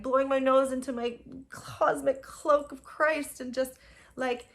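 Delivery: usual speaking voice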